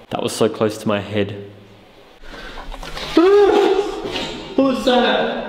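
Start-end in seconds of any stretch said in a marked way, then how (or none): 2.2-4.5 sharp high gasp of fear; frightened gasp. Real, not acted. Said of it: "I'm petrified of them". 4.6-5.5 high startled question; real fright. Real, not acted. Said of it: "I'm petrified of them".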